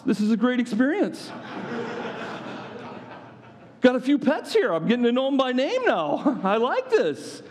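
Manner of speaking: high, incredulous and sarcastic; mocking Pharaoh. Preacher mocking Pharaoh's choice of tomorrow, high and sarcastic. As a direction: ridicule the stubborn king.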